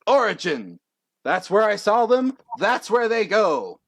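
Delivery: high, projected showman's declaration; grand proclamation. Acted. Young contestant showing the stadium his power, grandly. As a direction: declare the power with theatrical pride.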